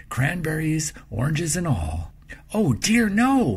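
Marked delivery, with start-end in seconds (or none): 2.5-3.6 high, loud dismayed cry; a girl's alarmed exclamation; oh dear, cried out high and loud. A narrator's reading. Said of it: "Margaret cried".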